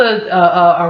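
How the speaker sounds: ordinary talk, nothing marked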